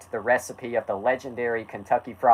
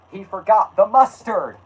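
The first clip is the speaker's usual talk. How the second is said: high, loud storyteller's exclamation; dramatic outcry; 9 semitones higher, 5 dB louder than usual. A narrator's reading. Narrator reveals the employee's mistake, dramatic outcry. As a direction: cry out the shocking discovery.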